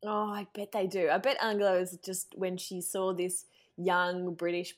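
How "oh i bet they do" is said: usual speaking voice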